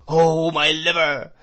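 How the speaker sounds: high, loud, drawn-out wail; crying out whiningly. A narrator's reading. Old man throwing the jacket on a shelf, wailing cry. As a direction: stretch it into a droning cry. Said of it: "cried the old man".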